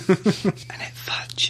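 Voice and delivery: whisper. Real, not acted. Joking aside about old man.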